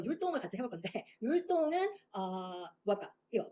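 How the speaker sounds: ordinary delivery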